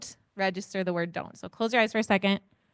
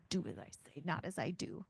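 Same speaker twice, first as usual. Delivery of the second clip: muttered saying; 4 semitones lower, 14 dB quieter than usual. Retold or imitated. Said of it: "come out of your mouth like".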